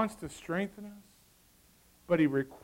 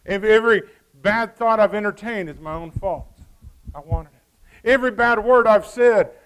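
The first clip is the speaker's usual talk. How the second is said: loud, insistent confession-style preaching; full-force preaching; 5 semitones higher, 13 dB louder than usual. Acted. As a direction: preach it hard, owning every choice.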